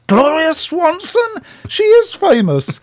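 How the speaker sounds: high, loud and slow in disbelief; astonished. Retold or imitated. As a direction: gasp out the name as if stunned. Partner astonished at the name.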